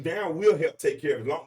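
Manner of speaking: ordinary talk, nothing marked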